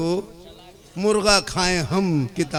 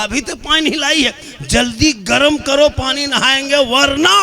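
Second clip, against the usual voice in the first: bossy demand; 7 semitones higher, 5 dB louder than usual. Acted. Husband orders wife loudly.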